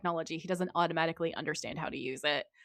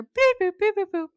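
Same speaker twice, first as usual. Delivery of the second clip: high mock button beeps; beeping noises; 14 semitones higher, 10 dB louder than usual. Imitating phone button beeps.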